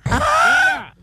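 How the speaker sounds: sudden high shouted call. Acted. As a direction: yell it out sharply.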